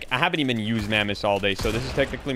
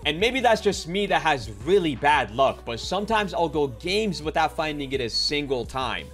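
video intro; 6 semitones higher than usual. Acted. Upbeat YouTube video intro.

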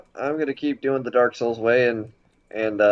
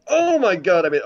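relieved surprise; 7 semitones higher, 4 dB louder than usual. Real, not acted. Surprised relief after jump.

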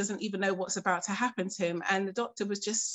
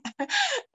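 relieved laugh; 4 dB louder than usual. Real, not acted. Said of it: "because I'm not crying".

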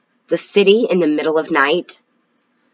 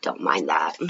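hushed aside whisper; 12 semitones lower, 8 dB quieter than usual. Real, not acted. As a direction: whisper it under your breath.

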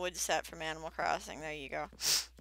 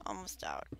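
whispering; 11 semitones lower, 9 dB quieter than usual. Real, not acted. Straining to finish mining.

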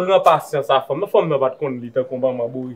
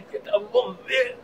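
high, drawn-out words after a laugh; laughing repeat; 3 dB quieter, much slower than usual. Acted. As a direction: squeeze it out slowly, high from laughing. Repeats line after laughing fit.

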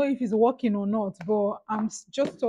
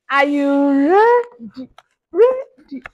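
loud hype call; 4 semitones higher, 11 dB louder, slower than usual. Real, not acted. Loud hype before opening.

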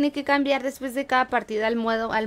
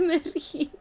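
higher and louder, giggling while asking "Mowgli"; laughing question; 5 semitones higher, 6 dB louder than usual. Real, not acted. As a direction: laugh through the puzzled question. (preguntando entre risas, más agudo y fuerte)

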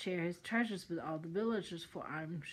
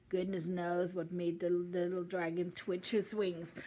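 plain reading voice

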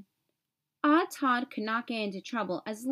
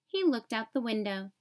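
usual speaking voice

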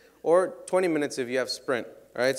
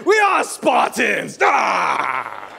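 mock Spartan battle cry; 3 semitones higher, 7 dB louder, much slower than usual. Performer hamming for the crowd, slow loud war cry. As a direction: roar it like a movie warrior.

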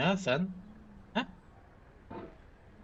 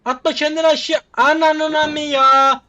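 loud, higher, dragged-out curse at the game; frustrated yell; 9 semitones higher, 9 dB louder than usual. Real, not acted. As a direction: yell at the falling character. Character about to fall. (oyuna bağıran yüksek, uzatılmış öfkeli küfür)

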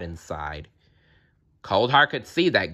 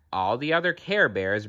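plain reading voice

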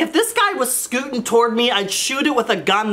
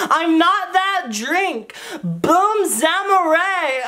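put-on slurred, slow, higher drunk voice; drunk texter; 7 semitones higher, much slower than usual. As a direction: insist you're not drunk while slurring. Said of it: "sounds exactly like a drunk text".

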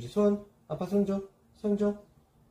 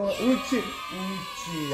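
high, drawn-out praise for the dog; squeaky praising a dog; 4 semitones higher, much slower than usual. Real, not acted. Praising a dog for giving paw. (강아지 칭찬하는 늘어진 높은 목소리)

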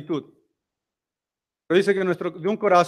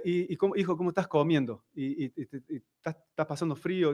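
tender mother; 3 semitones lower, 9 dB quieter than usual. Retold or imitated. Madre habla al hijo preso.

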